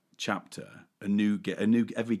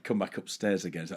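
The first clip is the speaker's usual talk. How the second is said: normal talking voice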